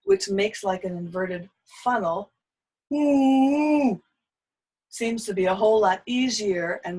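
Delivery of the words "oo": "oo" sung through tight rounded lips, higher and louder; tight-lipped oo. Shown as a demonstration. Voice teacher shows students the tight "oo" vowel. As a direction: demonstrate "oo" as a narrow, funnel-shaped vowel. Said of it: "On a very tight-lipped oo sound".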